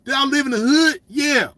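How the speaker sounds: ordinary talk, nothing marked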